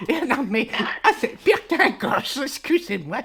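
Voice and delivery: high, giggling through the words; can't stop giggling at him; laughing. Acted. Old lady giggles at her brother beating the hens, very high. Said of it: "j'en rigole".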